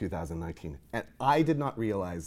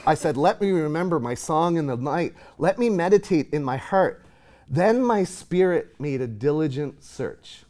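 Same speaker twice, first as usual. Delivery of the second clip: raised, louder lament read from the psalm; scripture reading; 5 semitones higher, 6 dB louder than usual. Acted. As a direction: give voice to the psalmist's questions. Reads psalm aloud in sermon.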